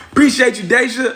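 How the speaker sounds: high, loud shout-out; shouted thanks. Real, not acted. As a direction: holler your thanks to a supporter. Calling out thanks to a viewer.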